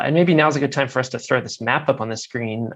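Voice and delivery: ordinary delivery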